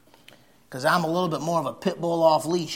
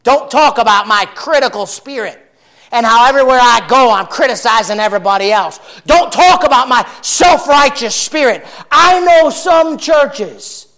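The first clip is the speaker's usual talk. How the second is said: loud, high, driving pulpit voice; full-force preaching; 9 semitones higher, 14 dB louder than usual. Acted. Preacher rebuking critics in the congregation, full-force preaching. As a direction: hammer it at the congregation.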